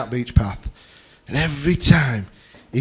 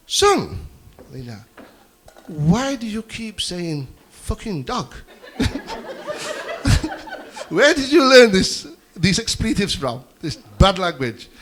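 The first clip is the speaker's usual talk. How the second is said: slow, higher-pitched careful parent; patient father questioning; 6 semitones higher, slower than usual. Acted. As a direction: ask the child with measured, exaggerated care. Acted father carefully questioning his son.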